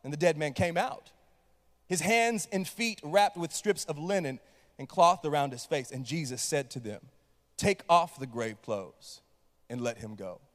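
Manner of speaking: low, measured Bible reading; scripture reading. Acted. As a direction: read the passage calmly and solemnly. Reads scripture aloud.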